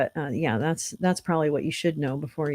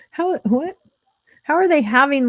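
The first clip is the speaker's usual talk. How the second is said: baffled; 8 semitones higher, 5 dB louder than usual. Real, not acted. Confused by the vote.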